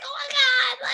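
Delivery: very high shrieking laugh; squeal, overwhelmed with joy; fangirl shriek. Real, not acted. Overwhelmed fan.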